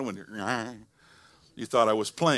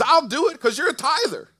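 much higher, much louder booming voice of God; God's voice, booming; 14 dB louder than usual. Acted. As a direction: proclaim it as God, soaring over the church. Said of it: "He goes".

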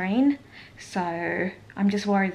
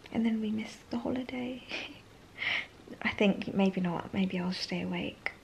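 hushed whisper so nobody wakes; soft whisper, almost no voice; 8 dB quieter than usual. Real, not acted. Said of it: "whispers, people asleep".

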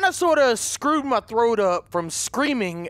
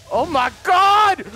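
slow, loud startled cry; shocked shout; 7 semitones higher, 6 dB louder, slower than usual. Real, not acted. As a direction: burst out in real shock. Finds bloody scene in showers.

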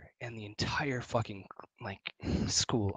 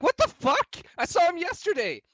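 retold shocked outburst, much higher and louder; mock disbelief; 4 dB louder than usual. Kid stunned that his friend is still camping, mock disbelief. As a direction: play the kid's baffled outrage. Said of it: "And I'm like".

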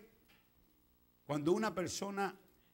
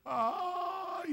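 long high wail; mock a drawn-out groaning "ay"; high, stretched moan of lament; imitated moan; 13 semitones higher than usual. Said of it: "está gimiendo".

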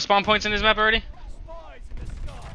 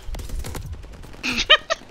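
quiet, high-pitched laughing; real high chuckle; giggle; 5 dB quieter than usual. Real, not acted. Laughs during play.

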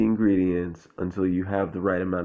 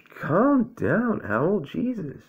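high, exasperated owl voice; exasperated with a jumpy friend; irritated high voice; 9 semitones higher, 3 dB louder, much slower than usual. Acted. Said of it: "Owl irritated at Otter".

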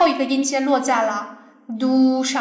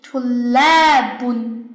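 tulabun very slowly, la long and stressed; 2 semitones lower, 4 dB louder, much slower than usual. Shown as a demonstration. Teacher shows the answer, tulabun with drawn-out stressed la. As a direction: swell and hold the la in tulabun. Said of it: "就是在这个来上面，".

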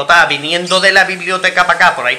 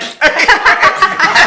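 high squealing giggle; high squealing laugh; 12 semitones higher than usual. Real, not acted. Man laughing at his own story, squealing high.

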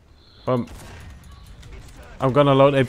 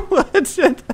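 high, loud burst of laughter through the words; cracking up; 14 semitones higher, 5 dB louder than usual. Real, not acted. Gamer watching his teammate's wrecked tank, cracking up. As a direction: squeal it out while laughing hard.

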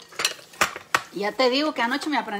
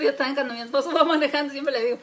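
ordinary talk

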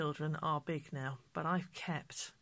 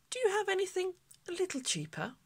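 put-on much higher and fast, small girl's voice; little girl Millie; 13 semitones higher, faster than usual. Millie asking the shopkeeper for something cheaper, high childish voice. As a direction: pipe up like a shy child.

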